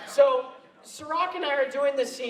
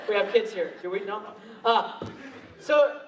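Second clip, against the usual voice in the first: normal talking voice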